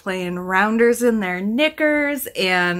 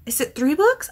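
ordinary talk, nothing marked

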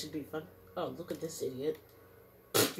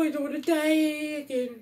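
mock whine; 9 semitones higher, 7 dB louder than usual. Fleeing danger in game.

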